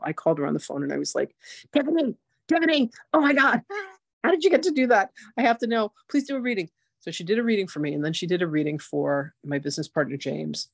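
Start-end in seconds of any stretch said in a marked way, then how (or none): 1.7-6.7 high, gushing rush of excitement, much higher; excited gushing. Retold or imitated. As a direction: beg her breathlessly like a thrilled fan. Calling friend about Akashic reading.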